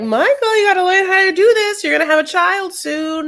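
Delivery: high, loud mock scolding; nagging women. Women telling Michael to learn diapering, high mock nagging. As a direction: pitch it way up as nagging female relatives. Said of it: "the women are like".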